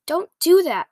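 narrated yell of alarm. A narrator's reading. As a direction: cry out as if your ear hurts. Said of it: "yelled the B F G".

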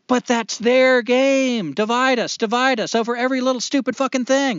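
worked-up outburst, pitch jumping high; heated rant. Real, not acted. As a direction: rail against them dividing everyone. Heated rant about division.

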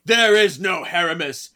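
booming possessed declaration. Retold or imitated. Possessed voice declaring to Andy, booming and loud. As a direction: announce it like a possessed demon. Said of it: "he's like".

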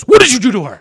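loud, high-pitched mock confrontation; accusing teacher. Teacher confronts him in the hallway.

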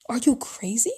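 whispered disbelief; scandalised whisper. Retold or imitated. Parent asks if you're crazy, in a whisper. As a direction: hiss it as a shocked parent. Parents scolding about the party.

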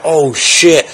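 alarmed realization. Retold or imitated. Storyteller facing a gun, high alarmed outcry. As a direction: re-enact sudden alarm, voice jumping up. Seeing gun pointed at him.

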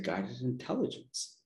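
soft half-voiced repeat, a bit stronger.

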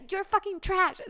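high, loud pretend insult; hammed-up scornful yell; mock shout. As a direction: yell it at him in play.

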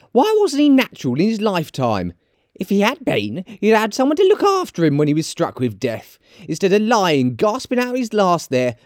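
high-pitched, indignant character voice; high, scolding woman; scornful charwoman. Acted. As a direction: condemn him in a high voice. Charwoman condemns the dead man.

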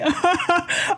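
short, high giggle; quick burst of giggling; amused laugh. Real, not acted. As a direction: break into a real high-pitched laugh. Laughs at own text.

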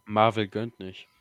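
loud, slow grumble; loud complaint. Real, not acted. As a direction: declare it loudly and slowly.

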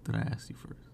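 unvoiced teasing whisper. Real, not acted. Dodging his friend's question in a sly whisper. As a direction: breathe it out as a coy secret.

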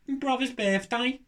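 high, slow mock Brummie; Brummie accent. Shown as a demonstration. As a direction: lay on a comic Birmingham accent. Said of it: "Birmingham has to be the worst one".

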